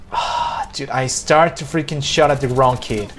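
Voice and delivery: normal talking voice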